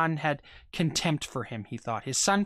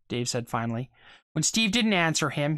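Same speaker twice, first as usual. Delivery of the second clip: ordinary delivery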